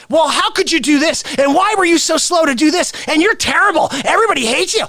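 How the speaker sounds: high, mock-accusing reporters; hostile questioning. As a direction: pile on accusations, high and insistent. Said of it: "instead of".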